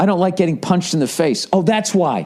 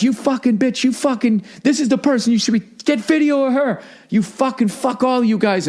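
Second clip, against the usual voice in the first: mock angry rant, pitched up; imitated cursing; 7 semitones higher than usual. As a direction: imitate a man ranting at everyone. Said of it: "starts cursing everybody out".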